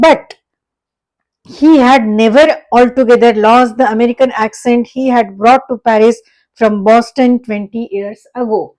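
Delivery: slow, loud, deliberate reading of the text; slow story reading. Acted. Teacher reading the story text to students, slow and loud. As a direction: read each English phrase out slowly for the class.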